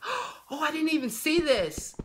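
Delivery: high-pitched surprised "oh" after a gasp; pleasant surprise. Real, not acted. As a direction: react high and amazed to a find. Gasps at an unexpected find.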